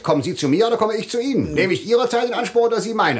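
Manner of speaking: sharp, fast, high indignant questions; angry rebuke. Acted. The official scolds Motes, fast and indignant. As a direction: put him firmly in his place.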